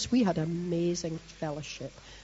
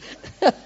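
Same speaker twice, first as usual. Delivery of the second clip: high, loud burst of laughter; 8 dB louder than usual. Real, not acted. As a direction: laugh out loud at your own joke. Laughing at own joke.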